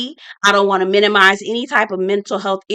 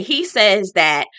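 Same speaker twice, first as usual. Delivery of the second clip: ordinary delivery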